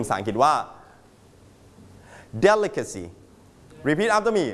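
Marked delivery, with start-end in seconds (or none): 2.5-3.0 "delicacy" with DEL high, the rest falling; stress on first syllable. Shown as a demonstration. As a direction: hit DEL in "delicacy", then drop away. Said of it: "Straight on the first syllable". (เน้นเสียงที่ DEL พยางค์แรกของ "delicacy")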